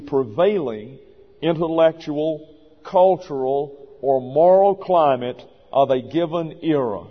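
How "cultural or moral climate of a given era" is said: ordinary talk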